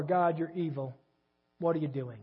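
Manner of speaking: low, soft sulking complaint; whiny Jonah. Retold or imitated. Preacher voicing Jonah moaning at God, low and sulky. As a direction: mope it out like a pouting prophet.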